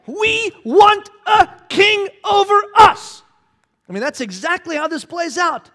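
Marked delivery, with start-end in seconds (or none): 0.0-3.2 high, loud, slow demand; loud insistent crowd demand. Retold or imitated. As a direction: shout it like a stubborn mob. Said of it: "They said".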